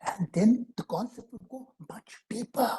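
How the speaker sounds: unvoiced whisper. Real, not acted. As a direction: drop to a secret whisper. Whispered aside in lecture.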